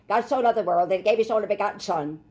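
quick, offhand reading, no feeling; rushed, matter-of-fact recital; just reading it off. Shown as a demonstration. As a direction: rattle it off like a routine verse. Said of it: "Most Christians just read that".

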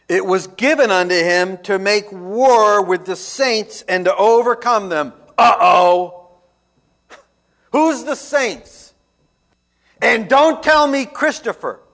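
loud, emphatic pulpit delivery; full-force preaching. Acted. Preacher warning the church about the saints, loud preaching. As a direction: drive the warning home. Preacher reads verse, then challenges.

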